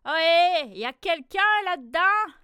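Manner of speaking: loud, high hail into the mitten; fox calling out. A narrator's reading. Fox outside the mitten hailing the animals, loud high call. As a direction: call out to whoever is inside.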